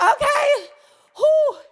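high, strained workout reaction; winded gym-goer. Retold or imitated. Preacher acting out her own workout, high mock strain.

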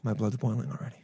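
whisper. Real, not acted. Listener fuming at the story.

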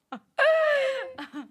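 high-pitched burst of laughter. Real, not acted. Teased about crying over the singer, high laughter.

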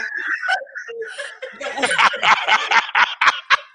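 long, high, loud cackle; big laugh. Real, not acted. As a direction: burst out laughing at your own joke. Laughs at her own joke.

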